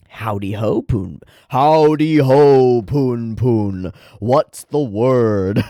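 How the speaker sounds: loud, drawn-out whimsical deity voice; voice for God. Acted. God answering Poon Poon's chant, slow and loud. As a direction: boom it out slowly like a silly god. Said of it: "I've been doing this voice for God".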